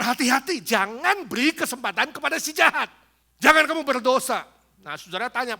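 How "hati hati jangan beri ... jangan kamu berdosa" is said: loud, high preacher's warning; forceful warning. Acted. Preacher warns congregation against giving evil a chance, forceful warning. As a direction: thunder it out as a stern command.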